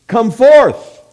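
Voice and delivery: high, loud commanding shout; sudden ringing summons; calling out. Retold or imitated. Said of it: "calls out".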